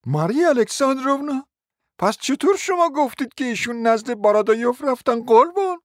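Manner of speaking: sugary, high and sly as she teases him; charming sweet voice. A narrator's reading. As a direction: coo it like a flirtatious society lady. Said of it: "با صدای دلفریبی گفت".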